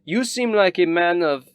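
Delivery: high, loud snooty noble; a pompous rich lady fawning on a guest; Rabaya's voice. Acted. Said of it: "Rabaya says".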